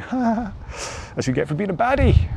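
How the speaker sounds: high, mocking ha ha ha; gloating laugh. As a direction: gloat like you've won. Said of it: "you're like".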